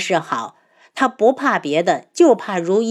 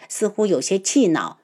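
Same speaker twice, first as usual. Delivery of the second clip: usual speaking voice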